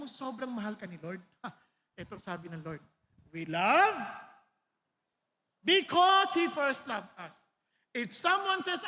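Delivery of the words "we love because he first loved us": loud, slow proclaiming of the verse; forceful scripture reading. Real, not acted. Preacher proclaiming a verse to the congregation, loud and slow. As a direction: declare the verse slowly, with full force.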